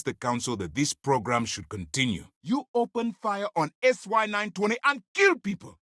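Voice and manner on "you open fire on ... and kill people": high shout, growing louder; furious confrontation. Acted. Officer confronting an agent who killed people, furious confrontation. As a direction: explode at them, pitched far up.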